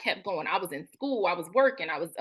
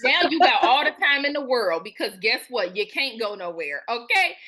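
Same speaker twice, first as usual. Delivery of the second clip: sassy Spirit; 6 dB louder than usual. Retold or imitated. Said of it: "it was like".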